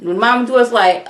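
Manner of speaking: normal talking voice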